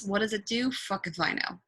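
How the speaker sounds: ordinary talk, nothing marked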